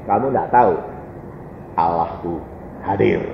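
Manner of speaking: low, hushed, solemn reply; jawaban khidmat. Retold or imitated. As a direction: answer gravely, soft and deep. Acts solemn reply to roommate. (jawaban lirih bersuara rendah)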